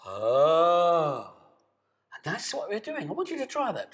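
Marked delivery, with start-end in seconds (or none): 0.1-1.3 long, loud open ah on the breath; sustained ah. Shown as a demonstration. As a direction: ride the sound on the breath like a surfboard. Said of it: "We all make sound".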